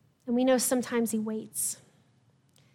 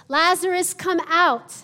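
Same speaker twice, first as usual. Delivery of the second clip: loud call; 5 semitones higher, 8 dB louder, slower than usual. Acted. Jesus calling Lazarus out of the tomb, a loud commanding call. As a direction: call the name out loud and high. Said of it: "called out in a loud voice".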